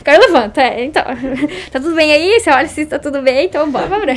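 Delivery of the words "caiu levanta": laughing through the words; laughing echo. Real, not acted. Guest laughing while echoing the host's motto, laughing.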